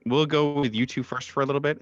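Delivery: ordinary talk, nothing marked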